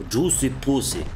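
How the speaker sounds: mock Slavic accent, tongue in cheek; goofy Slavic accent for a cheeky joke; hammed-up Slavic accent. Shown as a demonstration. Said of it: "the best thing to say in a Slavic accent".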